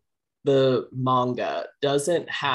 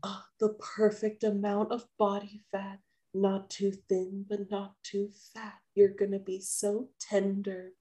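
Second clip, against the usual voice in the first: higher, much softer, relishing; gloating cannibal; 7 semitones higher, 7 dB quieter than usual. Retold or imitated. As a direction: purr over the meal. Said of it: "she's like".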